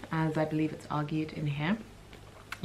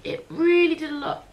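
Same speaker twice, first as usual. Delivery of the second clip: high, loud gush; excited; 11 semitones higher, 9 dB louder than usual. Real, not acted. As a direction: burst out with enthusiasm. Gushing about the book.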